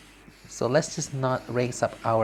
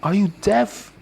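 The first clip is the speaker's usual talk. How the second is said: angry husband; 7 semitones higher, 5 dB louder, slower than usual. Acted. Husband snapping at wife.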